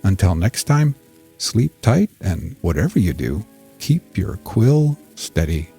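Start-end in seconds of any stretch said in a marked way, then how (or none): none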